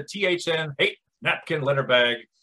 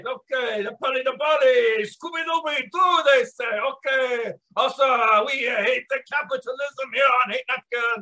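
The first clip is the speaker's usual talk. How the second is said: bad Russian accent; 4 semitones higher than usual. Podcast host opening the show in a bad Russian accent. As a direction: play a broken-English Russian podcast host. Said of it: "hold down just a bad, uh, Russian accent".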